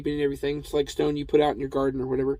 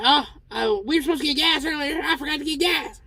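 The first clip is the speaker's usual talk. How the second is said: put-on much higher Cotton Hill-style old-man voice; [name] as Cotton Hill; 11 semitones higher than usual. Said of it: "apparently I'm gonna do it".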